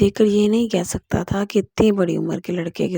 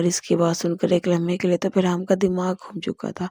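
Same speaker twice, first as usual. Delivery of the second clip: plain reading voice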